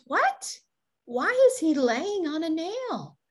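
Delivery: high disbelieving "What?"; high-pitched mock disbelief; incredulous. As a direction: sound baffled by the answer. [name] incredulous at answer.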